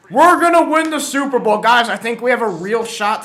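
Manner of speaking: high, loud imitation of optimism; mock hyped fan. Fan mimicking hopeful Lions fans, mock hype. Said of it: "all this stuff was being said".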